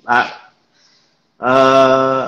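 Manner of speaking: ordinary talk, nothing marked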